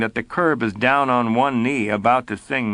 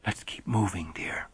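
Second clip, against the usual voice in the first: whispered and much softer; 4 semitones lower, 11 dB quieter than usual. Acted. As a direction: murmur it close, urging him away. Urging Belford to leave quietly.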